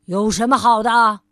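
loud scornful snap; crabby man sneering at cheer; grumpy retort. Acted. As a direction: throw the question back irritably. Grumpy man snaps back.

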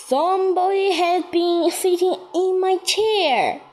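lightly voiced mother bear; a hint of the mother bear; middle-sized bear voice. A narrator's reading. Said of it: "the middle-sized bear said".

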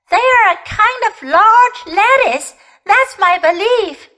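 high-pitched little rabbit voice; opinionated rabbit. A narrator's reading. Rabbit declaring the strangers are lettuce, high and opinionated. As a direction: pipe up high with firm belief.

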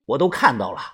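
plain reading voice

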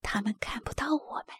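very soft, whispery and secretive; hushed voice. A narrator's reading. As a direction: whisper it so the hunters can't hear. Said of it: "康妮小声说". (悄声低语)

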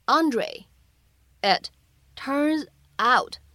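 slowly, each word set apart with pauses. Shown as a demonstration. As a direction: break the line into single words, slowly. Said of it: "需要注意哪些发音技巧". (逐词慢读)

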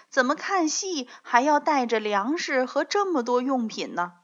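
usual speaking voice

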